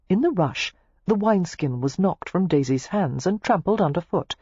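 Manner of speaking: plain reading voice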